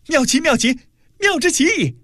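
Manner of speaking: very high, exultant exclamation; overjoyed cry. A narrator's reading. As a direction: burst out with uncontainable delight. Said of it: "再也忍耐不住， 大叫".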